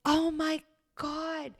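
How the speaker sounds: a light imitation of mom, high and slow; a hint of a shocked mother, drawn out; mom's shocked oh my god. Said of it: "she goes".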